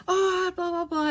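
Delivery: mock protesting child. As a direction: imitate a child's tantrum half-heartedly. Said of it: "they might be screaming, shouting".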